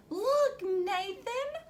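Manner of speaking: bright high mother calling to her son; excited mommy voice. A narrator's reading. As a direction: lift it up high like a mom pointing something out. Mommy voiced in picture book.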